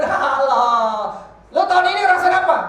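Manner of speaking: loud, high retold hail; imitating a boisterous greeter; acted greeting. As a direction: call it out big, then a quick question. Acts out someone greeting him.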